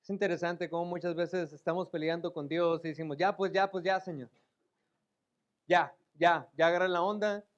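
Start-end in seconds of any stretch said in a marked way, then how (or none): 3.2-7.4 fastidio exagerado, más fuerte; impaciencia burlona. Pretend. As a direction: imita a quien le dice a Dios "ya, ya". Mocking believers impatient with God. (queja impaciente y burlona)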